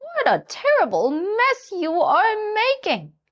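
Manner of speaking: high hen voice, scolding; disapproving hen. A narrator's reading. Hen scolds the donkey about his toys. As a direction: tut at the mess. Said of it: "shaking her head".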